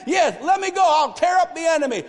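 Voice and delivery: high-pitched, pumped-up eagerness; acted fired-up fighter; Gideon. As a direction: charge in, raring to go. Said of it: "Gideon's getting pumped".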